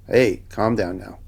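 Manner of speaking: low, slow, unbothered imitation; mellow, drawn-out mock calming; offhand calming. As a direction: imitate a lazy half-hearted intervention. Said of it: "he didn't immediately go".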